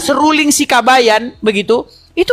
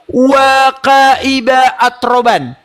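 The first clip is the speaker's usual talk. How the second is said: slow, drawn-out chanted recitation; slow Quranic chant with stretched vowels; much slower than usual. Shown as a demonstration. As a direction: recite it slowly and melodically like tartil. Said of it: "bunyinya begini".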